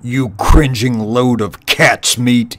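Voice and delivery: angry commander bellowing. Acted. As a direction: bellow an order at cowering troops. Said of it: "he bellowed".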